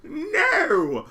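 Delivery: high bleating goat voice. Pretend. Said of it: "Nice goat voice there".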